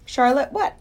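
high, keen curiosity; eager question. Acted. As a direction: ask as if dying to know. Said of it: "asked Wilbur eagerly".

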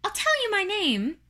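high tiny spider voice; small high-pitched character voice; first little spider. Acted. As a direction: pipe up as a little creature.

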